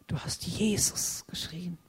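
hushed, tender whisper; soft whispered reminder. Acted. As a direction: speak it softly as a loving secret. Jesus's words, hushed.

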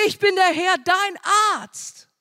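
high, loud ringing declaration; shouted proclamation. Acted. Preacher shouting God's promise to the congregation, loud and high. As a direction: cry it out like a battle call.

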